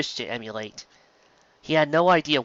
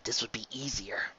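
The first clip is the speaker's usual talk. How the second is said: very soft, sighing; sighing to himself; 13 dB quieter than usual. A narrator's reading. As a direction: sigh it under your breath. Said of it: "Spike sighed to himself".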